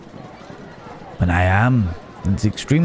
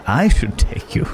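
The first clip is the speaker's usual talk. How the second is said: light chuckle around the words, higher pitch; chuckling; 5 semitones higher than usual. Acted. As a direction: laugh lightly as you offer. Chuckles while offering a trip.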